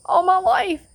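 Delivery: high, loud voice breaking with emotion; overcome, voice rising as tears come; testifying about God, crying out loud; real tearful emotion. Real, not acted. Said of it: "I began to cry".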